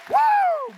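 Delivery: very high, loud shouted woo; preacher whooping with excitement; ecstatic whoop. Real, not acted. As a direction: let out a joyful holler. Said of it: "ecstatic whoop".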